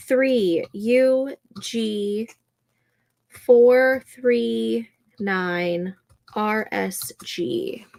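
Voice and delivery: slow, spaced-out letters and digits; slow dictation. Real, not acted. Streamer reading room code to chat, slow and spaced. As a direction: spell out the code slowly for viewers. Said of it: "reading a room code slowly".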